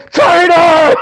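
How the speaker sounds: high, loud villain yell; Kylo Ren shout. Retold or imitated. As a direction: bellow it like a Sith. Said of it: "he's gonna be like Kylo Ren".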